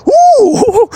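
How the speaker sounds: high laughing monkey hoots; monkey hooting. Pretend. Mocking a vain person as a monkey, laughing hoots. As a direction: hoot up high like a giddy monkey. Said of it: "You go".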